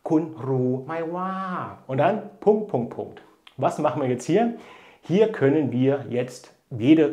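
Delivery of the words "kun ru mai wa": "Kun ru mai wa" drawn out slowly, each Thai syllable given its tone; teacher models "Kun ru mai wa" slowly; slow Thai demo. Shown as a demonstration. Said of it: "das Wörtchen wa".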